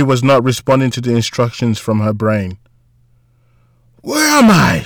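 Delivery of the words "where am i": high-pitched cry of confusion; desperate near-scream. A narrator's reading. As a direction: cry out as if lost and panicking. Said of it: "she wanted to scream".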